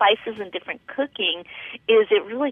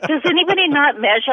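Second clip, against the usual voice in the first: incredulous question; 8 semitones higher than usual. Retold or imitated. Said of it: "I was like".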